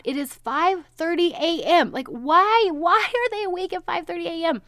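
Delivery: high, loud disbelief; why are they even awake; mock-outraged disbelief at the hour; incredulous. Real, not acted. Kids up at 5:30 am.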